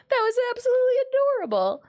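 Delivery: fast, high, laughing through it; gushing while cracking up; adoring laugh-talk. Real, not acted. Laughing through delighted reaction.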